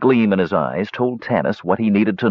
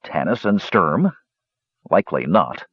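plain reading voice

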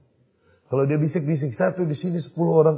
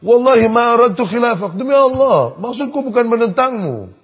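Umar shouting back, much higher and louder; louder, higher quarrel; 7 semitones higher, 9 dB louder than usual. Retold or imitated. Umar outshouting Abu Bakar in the argument, loud and high. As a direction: swear it at full volume, rising over him. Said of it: "Kata Umar kencang lagi, naik lagi".

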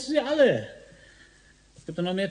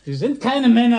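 higher and louder, hurt and furious; angry outburst; 8 semitones higher, 7 dB louder than usual. Acted. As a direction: burst out in his friends' defence. Suspect flares up defending his friends.

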